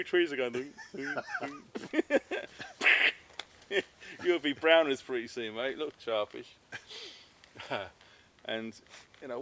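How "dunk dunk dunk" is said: "dunk, dunk" in a high, mock creaking voice; tree creak noise. Describing the swinging hammock to camera, mock creaking sound. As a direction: imitate the trees creaking under the hammock.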